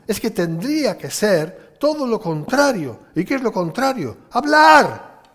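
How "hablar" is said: a high, loud shout of the single word Hablar; shouted answer. Real, not acted. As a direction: fling the answer out to the whole congregation. Respuesta clave predicada. (gritando fuerte la palabra "Hablar")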